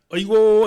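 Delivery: high, loud keening 아이고; loud mourning wail. Shown as a demonstration. Pastor demonstrating grief to the congregation, loud wailing cry. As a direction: cry out 아이고 as at a parent's death. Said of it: "아이고는 그냥 친척".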